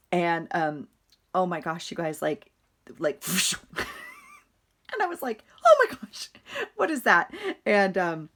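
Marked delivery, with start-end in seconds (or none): 3.0-5.4 laughing. Real, not acted. Laughing while telling bat story. 5.6-7.3 startled cry. Retold or imitated. Startled wife quote in bat story.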